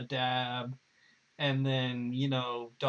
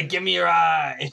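laughing raccoon voice; 4 semitones higher, 10 dB louder than usual. Retold or imitated. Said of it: "Rocket quote continued".

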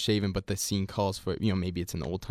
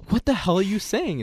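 high, louder exasperated "What the hell"; retold bafflement; 11 semitones higher, 5 dB louder than usual. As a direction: blurt it out completely lost. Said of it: "I'm like".

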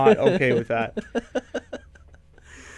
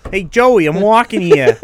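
loud, high hammed-up New York accent; 5 semitones higher, 7 dB louder, slower than usual. Host shows off a stock phrase, loud New York accent. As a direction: holler it like a cartoon New Yorker. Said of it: "do a New York accent".

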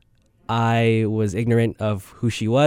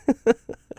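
embarrassed laugh; 14 semitones higher than usual. Real, not acted.

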